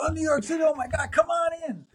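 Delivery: high-pitched mock exclamation of delight; excited welcome. English engineer welcomes New York kid into the studio, imitated excitement. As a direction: imitate a thrilled, squealed welcome without much volume.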